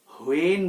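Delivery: "হোয়েন" with a sounded h, "ho-en"; mispronounced h. Shown as a demonstration. Teacher showing learners the common mistake, "হোয়েন" with added h. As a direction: start "হোয়েন" on a breathed "ho". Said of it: "হ এখানে আসবে না".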